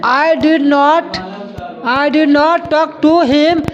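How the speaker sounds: very slow, word by word; slow dictation. Shown as a demonstration. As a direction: dictate slowly to the class. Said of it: "मैंने उससे बात नहीं की". (धीरे-धीरे लिखवाना)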